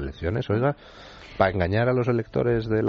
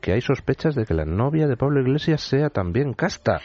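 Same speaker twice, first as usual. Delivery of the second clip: higher, mock incredulous gasp; mock surprise; 7 semitones higher than usual. Feigned shock at the scandal.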